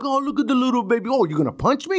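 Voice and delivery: high-pitched jeering imitation; mocking taunt. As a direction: goad him like a crybaby. Retold cop taunting him.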